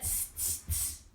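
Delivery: soft, voiceless "tss" of mimicking monkeys; monkey tsking. A narrator's reading. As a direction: tsk back teasingly like monkeys. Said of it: "and said".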